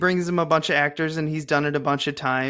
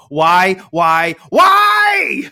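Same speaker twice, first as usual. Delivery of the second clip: loud, rising desperate why; exasperated yell; 3 semitones higher, 9 dB louder, much slower than usual. Real, not acted. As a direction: throw up your hands and shout. Exasperated repeated question.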